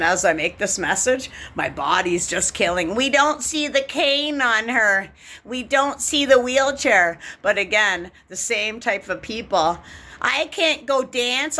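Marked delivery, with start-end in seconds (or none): 3.0-7.2 mock gossip. Mocking gossipers about her. 10.2-11.6 whiny mock. Mocking complainer.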